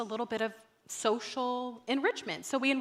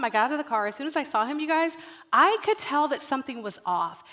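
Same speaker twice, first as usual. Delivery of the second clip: normal talking voice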